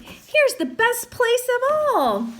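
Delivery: put-on high, slow, eager child voice; excited boy voice. A narrator's reading. Sam showing off the hamsters, high and slow. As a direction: lift it up like a proud little kid. Sam's voice in read story.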